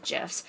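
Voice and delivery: "gifs" with a soft g, like "jifs". Shown as a demonstration. Puzzled at own slip, "gifs" with a soft g. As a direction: say "gifs" starting with a j sound. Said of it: "I don't know why I said gifs".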